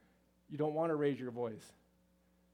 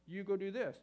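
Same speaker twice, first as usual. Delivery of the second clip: direct order; 5 semitones higher, 3 dB louder than usual. Acted. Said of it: "Just say it to us direct".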